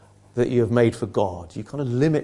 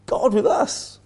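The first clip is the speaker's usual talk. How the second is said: "God with us" pitched much higher, rising; high emphatic refrain; 9 semitones higher, slower than usual. Shown as a demonstration. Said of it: "refrain pitched high".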